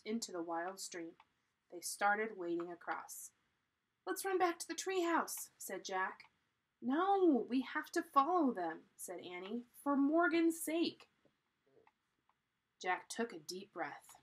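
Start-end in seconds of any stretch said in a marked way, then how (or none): 4.1-5.5 high-pitched, anxious urging; urgent. A narrator's reading. Jack wants to flee from the stream, urgent. Jack urges escape from the ninjas. 6.8-8.9 high, loud objection; insistent. A narrator's reading. Annie overrules Jack at the stream, insistent. 9.8-11.1 high, loud and drawn out; insistent. A narrator's reading. Annie pleads to follow for Morgan's sake, insistent.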